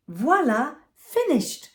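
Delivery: mother finishing the detangle, triumphant voila; cheerful exclaim. Acted. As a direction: announce it's done with a flourish. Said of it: "Mama exclaimed".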